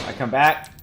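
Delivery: usual speaking voice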